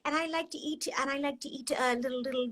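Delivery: high, put-on voice of a little squirrel. Shown as a demonstration. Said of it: "I am a little squirrel".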